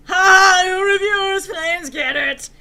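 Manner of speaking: very loud, high taunting cry; mocking outburst. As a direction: crow it at the critics.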